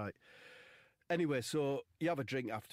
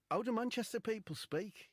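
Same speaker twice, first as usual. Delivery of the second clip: lass's voice; 12 semitones higher than usual. Retold or imitated. Retold lass quoted.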